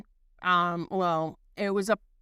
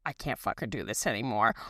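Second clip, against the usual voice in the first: much lower, hushed voice; low retold aside; 10 semitones lower, 6 dB quieter than usual. Retelling an aside to companion in a screening, low and hushed. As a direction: imitate a discreet plea under your breath.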